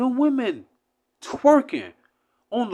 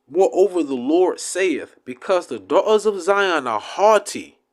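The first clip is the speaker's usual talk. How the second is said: scripture reading; 4 dB louder than usual. Acted. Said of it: "Listen to this curse".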